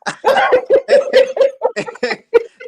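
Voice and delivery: real, loud, high-pitched laugh; loud high burst of laughter. Real, not acted. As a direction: crack up loudly and high.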